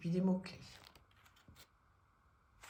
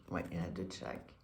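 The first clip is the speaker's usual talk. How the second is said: low, quick, quiet mutter; muttering to herself; 6 dB quieter, much faster than usual. Real, not acted. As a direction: mutter it under your breath. Repeats the count to herself.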